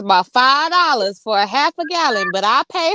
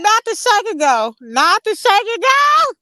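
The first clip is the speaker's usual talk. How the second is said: shocked; 8 semitones higher than usual. Real, not acted. Mock horror at wig.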